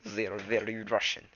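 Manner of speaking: mock Russian accent. Shown as a demonstration. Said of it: "Shadow Clan".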